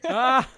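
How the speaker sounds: sudden high yelp of surprise; startled loud yelp; surprised yelp. Real, not acted. Reacts as her hips go.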